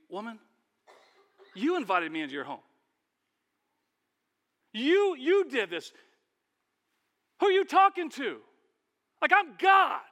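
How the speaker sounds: high, loud offended comeback; indignant retort. Pretend. Preacher imagining himself answering Martha, loud offended retort. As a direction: put her in her place, outraged.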